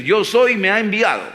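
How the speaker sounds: God proclaiming. Acted. God instructs Moses.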